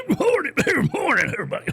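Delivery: high, strained, laughing radio greeting; a worn-out old DJ straining up high; older tired radio voice. Retold or imitated. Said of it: "as you get older".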